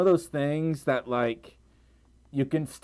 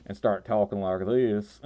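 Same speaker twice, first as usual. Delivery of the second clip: lower-pitched, thicker southern twang; turned-up southern twang; 6 semitones lower than usual. Shown as a demonstration. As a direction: lay on the southern accent. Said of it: "I can really turn things up".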